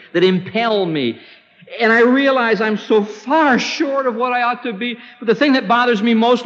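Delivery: confession building to a high, urgent plea; acted anguished confession; penitent prayer. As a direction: pour it out to God, pitch climbing. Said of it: "instead of saying, "Oh God".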